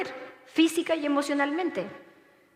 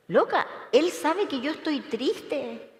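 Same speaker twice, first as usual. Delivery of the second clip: ordinary delivery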